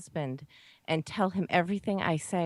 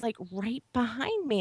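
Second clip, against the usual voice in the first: normal talking voice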